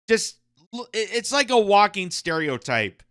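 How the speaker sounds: normal talking voice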